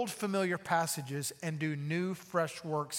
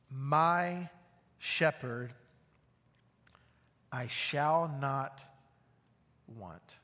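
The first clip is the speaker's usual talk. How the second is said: very slow reading; much slower than usual. Shown as a demonstration. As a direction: savour each word of the psalm. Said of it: "I'm gonna read very slowly".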